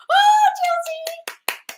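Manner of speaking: very high, loud joyful outburst; excited cheering. Real, not acted. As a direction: squeal with delight, then ease down. Cheering a viewer's good news.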